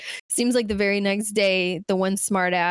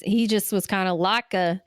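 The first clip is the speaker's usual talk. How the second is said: usual speaking voice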